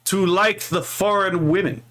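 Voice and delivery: lightly mock-pompous boast. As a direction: declare it like a tongue-in-cheek confession. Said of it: "I'm gonna become a racist against myself for a moment".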